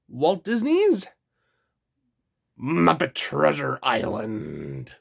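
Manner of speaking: slow, growly pirate announcer voice. Pretend. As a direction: drag it out like an old sea pirate. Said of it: "Sorry, that was my pirate voice".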